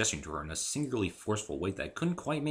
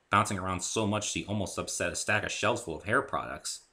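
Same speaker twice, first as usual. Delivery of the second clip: usual speaking voice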